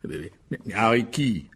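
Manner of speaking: low, stammering puzzlement; stammer, at a total loss; baffled. Acted. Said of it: "sans comprendre". (bafouiller sans comprendre)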